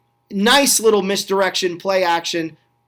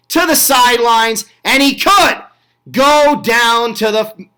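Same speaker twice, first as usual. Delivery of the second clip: rising, louder play-by-play burst as the return breaks; excited call; 5 semitones higher, 6 dB louder than usual. Real, not acted. Commentator calling a big kickoff return, excited and louder. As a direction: get swept up as the runner hits the sideline.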